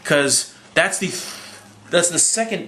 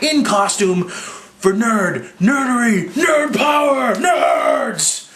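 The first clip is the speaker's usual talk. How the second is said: building nerd cheer; 9 semitones higher, much slower than usual. Real, not acted. Fan praising the midnight crowd, building from slow to a high shout.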